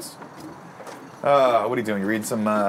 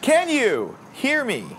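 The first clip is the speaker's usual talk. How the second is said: high, slow drawn-out call; 12 semitones higher, much slower than usual. Real, not acted. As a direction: stretch it out like calling across a yard. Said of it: "Yeah, I can hear you".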